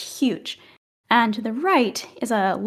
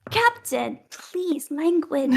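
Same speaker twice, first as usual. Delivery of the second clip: mild rebuke; 6 semitones higher, slower than usual. Acted. Someone scolds captain's language.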